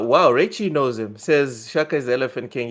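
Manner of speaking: normal talking voice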